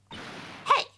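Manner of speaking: put-on high, scolding old woman; old lady voice. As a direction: tell the kids off like a granny. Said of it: "my old lady voice".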